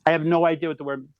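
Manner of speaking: ordinary talk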